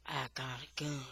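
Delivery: low, soft menace; quiet threat. A narrator's reading. J-Boy grabbing under his shirt, quiet threat.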